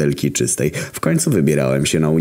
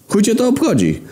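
mock polite reply. A narrator's reading. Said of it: "odparłem grzecznie ja".

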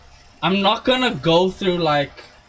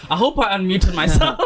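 laughing quote; 3 semitones higher than usual. Retold or imitated. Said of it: "I was like".

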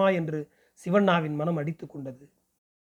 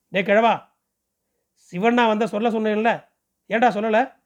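higher, louder angry inspector bark; angry scolding; 3 semitones higher, 4 dB louder than usual. A narrator's reading. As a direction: berate the old man as a police inspector. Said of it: "என்றான் கோபமாக". (கோபமான அதட்டல்)

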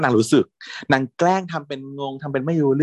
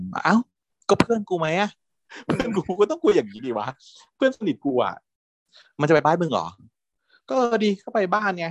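indignant retort; 7 semitones higher than usual. Retold or imitated. Retold argument between friends.

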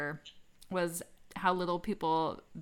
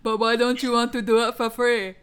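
loud, higher, hammed-up South African accent; playfully attempted South African accent, pitched up and loud; 5 semitones higher, 10 dB louder than usual. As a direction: belt out a rough mock South African accent. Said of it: "South African attempt at a South African accent".

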